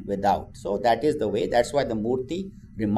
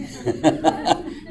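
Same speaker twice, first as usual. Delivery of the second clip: short breathy laugh; 5 semitones higher than usual. Real, not acted. Speaker laughs at own joke.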